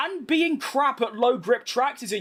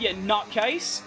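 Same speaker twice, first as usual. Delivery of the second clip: normal talking voice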